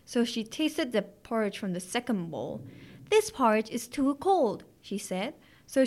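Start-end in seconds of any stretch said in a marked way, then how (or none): 3.1-4.6 higher, lightly voiced storybook complaint; mild complaint. A narrator's reading. Goldilocks tasting the second bowl of porridge, mildly complaining. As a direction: complain like a picky little girl.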